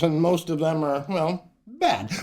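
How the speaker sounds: ordinary delivery, nothing marked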